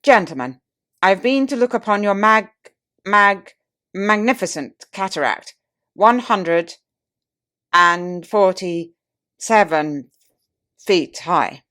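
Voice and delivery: loud, stammering "mag-, mag-" and a very slow "and forty-seven"; tipsy pompous orator. Retold or imitated. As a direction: boom like a wined-up statesman tripping over his words. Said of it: "the oratorical instinct struggled with difficulties".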